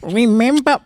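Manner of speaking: high, loud, through a mouthful; hardly able to speak for food; mouth full. A narrator's reading. Husband reminding wife, mouth crammed. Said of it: "mouth was so full that he could hardly speak".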